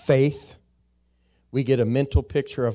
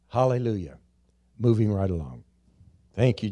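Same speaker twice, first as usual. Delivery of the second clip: normal talking voice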